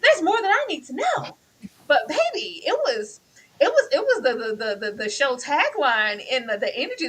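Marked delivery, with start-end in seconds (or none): 0.0-1.4 high, loud, drawn-up protest; mock outraged plea. Viewer begging the episode to continue, mock outrage. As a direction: wail it in comic disbelief.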